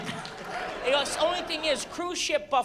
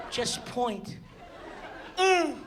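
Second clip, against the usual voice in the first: ordinary talk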